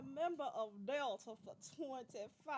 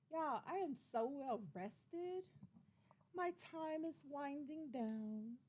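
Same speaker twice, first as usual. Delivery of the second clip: usual speaking voice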